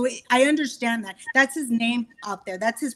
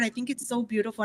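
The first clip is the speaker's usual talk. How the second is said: ordinary delivery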